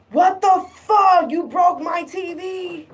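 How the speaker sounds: furious partner finding the smashed TV; outraged yell. Retold or imitated. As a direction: bellow it as the wronged boyfriend. Boyfriend discovers broken TV.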